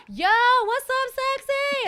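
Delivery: loud high playful catcall; bursting in on speaker with a flirty yo; flirty greeting. Retold or imitated. Her flirty call on speaker.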